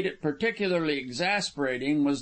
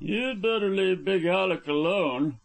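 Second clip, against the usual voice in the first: higher-pitched sour warning; surly advice in a raised character voice; rather sour; 5 semitones higher than usual. A narrator's reading. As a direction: warn him off grudgingly. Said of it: "rather sourly".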